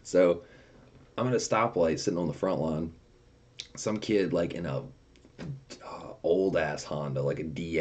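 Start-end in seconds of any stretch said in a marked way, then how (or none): none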